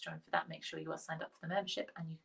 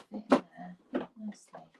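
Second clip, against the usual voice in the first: usual speaking voice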